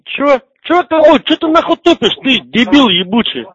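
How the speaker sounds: shrill, outraged shout up high; angry high yell. Real, not acted. Insults trade on a prank call.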